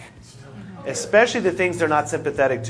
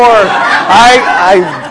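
normal talking voice

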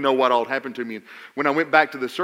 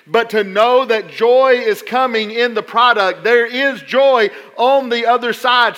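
louder and higher, driving every phrase home; full-force preaching; 7 semitones higher, 10 dB louder than usual. Acted. Preacher urging his suffering congregation, full-force preaching. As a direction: preach it out to the back row.

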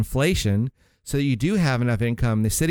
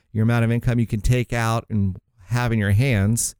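usual speaking voice

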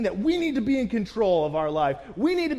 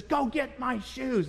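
wife in labor; 5 semitones higher, 3 dB quieter, slower than usual. Retold or imitated. Wife in labor giving orders.

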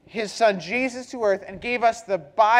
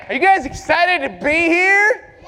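pumped-up hype question, higher; more excited; 4 semitones higher, 5 dB louder than usual. Real, not acted. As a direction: rev the crowd up louder, with a rising end. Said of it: "Let's try it again".

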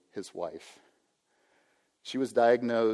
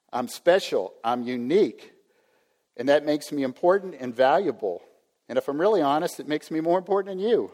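louder and higher, swelling with self-importance; building proud boast; 5 semitones higher, 7 dB louder than usual. Retold or imitated. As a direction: puff up more with each claim. Pastor voicing entitled inner thoughts.

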